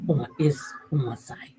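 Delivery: whispered, a bit slow; hushed aside. Real, not acted. Vlogger whispering the source to viewers. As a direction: whisper it like a secret.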